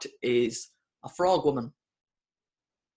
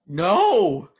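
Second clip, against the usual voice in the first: loud, higher-pitched cry of "no"; dramatic cry of dismay; 4 semitones higher, 8 dB louder than usual. Acted. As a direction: cry out in mock anguish. Mock cry of dismay at the plot.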